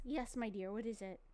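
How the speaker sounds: soft, fond telling-off of the dog; gentle mom voice to a needy dog; tender scolding. Real, not acted. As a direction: coax the pup to lie down. Gently scolding dog wanting pets.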